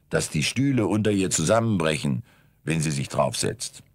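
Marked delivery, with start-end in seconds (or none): none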